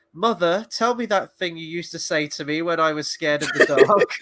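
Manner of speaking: put-on pleading like a scared kid; whiny child voice. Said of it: "Theo's all like".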